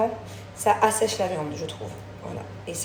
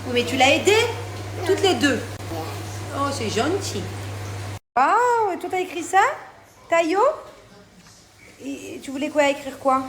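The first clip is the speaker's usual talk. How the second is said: high sweet mom voice; 7 semitones higher, 6 dB louder than usual. Real, not acted. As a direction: coo over the gift like a proud mom. Mother talking to child.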